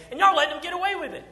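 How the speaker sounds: high, fast disbelief; high incredulous outburst; outraged squeak. Acted. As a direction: pitch it up in shock. Preacher's outraged question.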